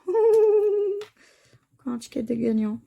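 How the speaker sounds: delighted laugh. Real, not acted. Another winning ticket, laughing with delight. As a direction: laugh out the lucky news.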